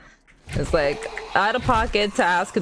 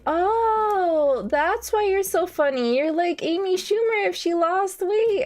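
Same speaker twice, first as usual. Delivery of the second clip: higher, bubbly mock girl voice, pitched up all through; imitating the girl; 11 semitones higher, slower than usual. Random girl at the bar gushing a backhanded compliment, higher mock voice. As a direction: play the gushing bar girl with a lightly hammed-up lift.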